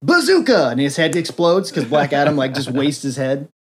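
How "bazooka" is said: much higher, loud heroic cry; mistaken magic shout. Retold or imitated. Kid facing the villain, mock heroic shout. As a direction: shout the wrong word with full confidence. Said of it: "And he's all".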